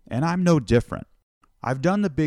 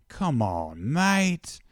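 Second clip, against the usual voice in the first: put-on softer Australian accent, swooping high on "mate"; 3 semitones higher, 3 dB quieter, much slower than usual. Aussie local answering by the waves, gently coaxing Australian accent. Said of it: "sorry if this accent isn't working out".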